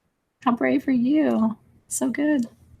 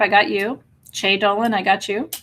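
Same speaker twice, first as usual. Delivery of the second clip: ordinary delivery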